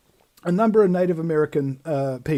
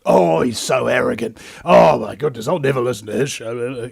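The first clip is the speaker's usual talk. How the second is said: mock sneering collectivist; 7 semitones lower than usual. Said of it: "And we're like".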